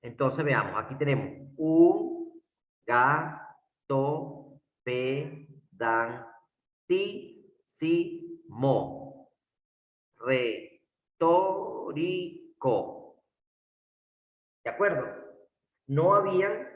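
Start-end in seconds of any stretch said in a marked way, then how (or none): 1.6-13.2 "gato pedantísimo, retórico" sílaba por sílaba, muy lento; separado en sílabas. Shown as a demonstration. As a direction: marcar una pausa tras cada sílaba de "gato pedantísimo, retórico". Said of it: "se separan de esa forma". ("gato pedantísimo, retórico" silabeado despacio, con pausas)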